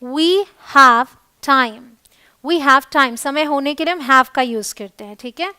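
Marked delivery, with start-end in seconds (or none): 0.0-1.8 very slow, every word held apart; slow teaching demo. Shown as a demonstration. Teacher modelling we have time for Hindi students, very slow. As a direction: model it slowly for learners. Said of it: "हमारे पास समय है".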